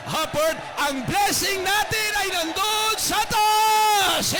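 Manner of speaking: shouting high, slow on "upward"; full-force preaching. Acted. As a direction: lift them up with a roaring call. Preacher's altar call climax. (sumisigaw na pangangaral)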